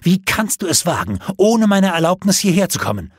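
high, loud, indignant demand; angry queen demanding an answer; outraged outburst. A narrator's reading. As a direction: confront him in rising fury. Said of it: "stieß sie hervor".

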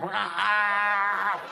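long imitated wildcat roar; hammed-up bobcat snarl over meat. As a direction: open up into a drawn-out cat yowl. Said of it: "the cat go".

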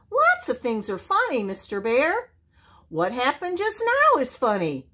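high-pitched small animal voice; little possum voice. A narrator's reading. Joe explaining laughter to the bear, high possum voice. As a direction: keep it high and lively.

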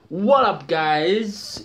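loud, high vlogger hello; catchphrase greeting. Acted. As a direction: burst in with the big greeting. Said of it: "just my own catchphrases".

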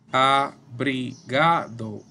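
"abrigado" slowly in four separated syllables; drawn-out "abrigado", piece by piece; syllable-by-syllable demo. Shown as a demonstration. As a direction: break up "abrigado" with a pause after each syllable. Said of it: "Uno, dos".

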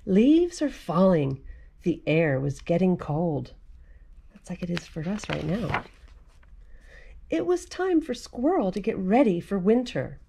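lively picture-book read-aloud; animated storybook voice for kids; reading to children. A narrator's reading. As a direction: read it like storytime for little ones.

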